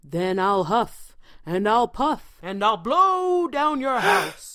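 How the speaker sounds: slow, loud wolf threat building to a roar. A narrator's reading. Wolf threatening the pig in the straw house, slow building roar. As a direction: draw it out slowly and swell to a roar.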